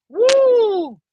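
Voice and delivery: high, loud shout of joy; joyful whoop. Real, not acted. Preacher rejoicing after the verse, whooping. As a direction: let out a whoop of delight.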